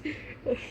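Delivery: breathy squeaky whine of cuteness; high overwhelmed whimper. Real, not acted. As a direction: whimper at something too cute.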